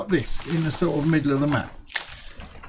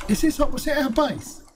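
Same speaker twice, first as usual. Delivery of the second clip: usual speaking voice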